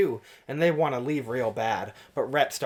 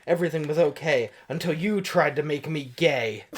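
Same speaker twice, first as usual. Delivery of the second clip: ordinary delivery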